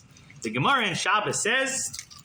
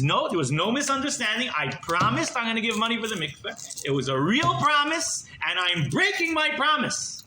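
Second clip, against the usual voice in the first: high, emphatic declaration; defiant; 5 semitones higher than usual. Retold or imitated. Congregant refusing the Gabbai before everyone, defiant. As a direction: insist on it, rising and slowing to the finish. Acted public refusal to pay.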